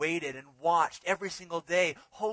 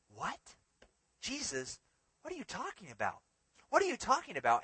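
baffled disciples; 6 semitones higher than usual. Acted. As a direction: play the puzzled disciple who just doesn't get it. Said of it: "the disciples are left doing this".

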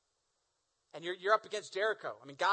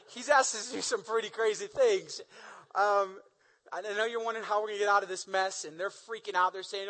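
usual speaking voice

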